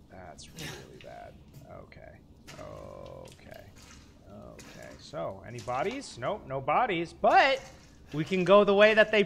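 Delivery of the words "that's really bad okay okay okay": hushed dread. Real, not acted. Creeping through horror game.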